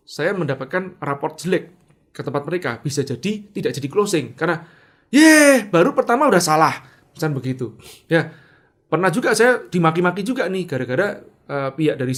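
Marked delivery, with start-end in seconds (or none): 5.1-6.7 loud mocking jeer, starting high; scornful complaint. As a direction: jeer loudly as an exasperated customer. Imitating a scornful customer's complaint. (cemoohan kesal dengan suara keras)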